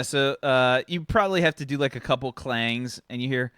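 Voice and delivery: ordinary talk, nothing marked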